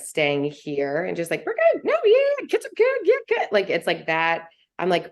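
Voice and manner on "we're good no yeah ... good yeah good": much higher, bright chirpy brush-off; mock cheerful surface chat, much higher; breezy small talk. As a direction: toss it off like everything's fine. Mock chipper small-talk answers.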